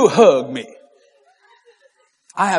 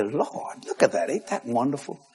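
soft praise; 5 semitones lower, 12 dB quieter than usual. Retold or imitated. Praising the Lord quietly.

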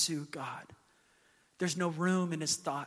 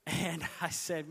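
ordinary delivery

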